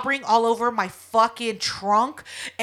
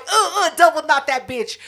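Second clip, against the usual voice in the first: frantic; 6 semitones higher, 5 dB louder than usual. Retold or imitated. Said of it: "I was like".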